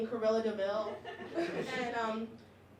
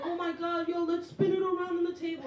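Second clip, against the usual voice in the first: put-on excited kids; 6 semitones higher than usual. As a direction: hype it up like thrilled first graders. Classmates excited over toy.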